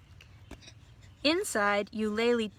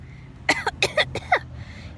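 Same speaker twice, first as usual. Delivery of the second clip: high choking cough; spluttering coughs; sputtering cough. A narrator's reading. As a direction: cough out the water. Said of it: "Eulalie coughed and sputtered".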